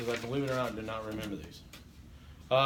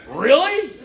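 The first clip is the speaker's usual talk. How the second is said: higher and louder really; mock surprise; 11 semitones higher, 12 dB louder than usual. Shown as a demonstration. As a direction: overplay the disbelief. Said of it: "you'll actually do that".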